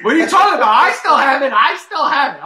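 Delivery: loud, emphatic insistence; indignant. Real, not acted. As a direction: protest that you still own it. Indignant insistence he owns game.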